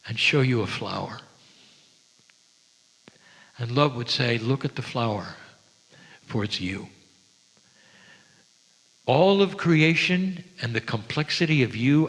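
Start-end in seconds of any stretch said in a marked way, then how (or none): 4.4-6.9 softer, lower, intimate; tender. Pretend. Love shows the listener a flower, tender and soft. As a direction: let it fall soft and close.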